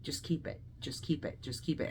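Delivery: soft, hushed urging from the heart; inner voice. Retold or imitated. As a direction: murmur it low and softly, like a private thought. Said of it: "something in my heart just told me".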